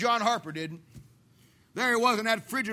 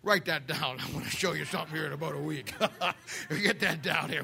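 much lower and softer, amused voice of God; God speaking to Michael; 8 semitones lower, 6 dB quieter than usual. Retold or imitated. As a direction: play God chuckling to an angel about what is coming. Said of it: "God up in heaven looking at Michael and saying".

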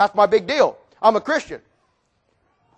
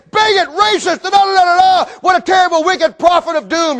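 higher, hammed-up shout of the crowd's insults; mock outraged yelling; 6 semitones higher than usual. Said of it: "everybody screamed and yelled".

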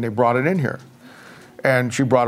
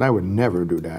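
soft reassurance; 8 semitones lower, 4 dB quieter than usual. Retold or imitated. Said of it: "And I said".

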